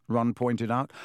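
ordinary delivery, nothing marked

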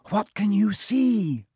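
high, slow worried question; anxious. A narrator's reading. Hermione asks Ron at the open trapdoor, high and anxious. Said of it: "Hermione said anxiously".

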